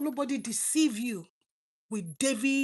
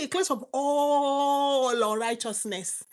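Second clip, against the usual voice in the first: solemn scripture quote; 2 semitones higher, slower than usual. Acted. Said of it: "He said".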